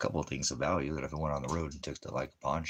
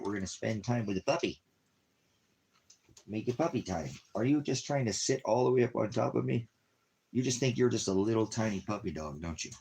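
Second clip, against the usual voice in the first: singsong puppy talk, pitched up; 7 semitones higher, 4 dB louder than usual. Real, not acted.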